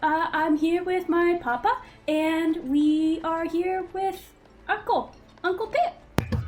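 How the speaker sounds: put-on high, loud child voice; gnome boy. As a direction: chatter like an innocent kid.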